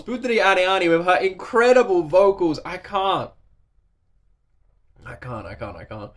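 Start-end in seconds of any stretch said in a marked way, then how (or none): 5.1-6.1 much lower and much softer, fading out; words failing, almost gone; overwhelmed murmur. Real, not acted.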